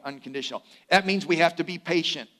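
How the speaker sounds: ordinary talk, nothing marked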